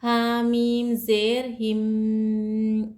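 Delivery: slow, drawn-out हिम with the sound held in the nose; nasal ghunna. Shown as a demonstration. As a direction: stretch हा मीम ज़ेर हिम slowly, humming the म nasally. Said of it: "आवाज़ को नाक में छुपाकर गुन्ना करना चाहिए".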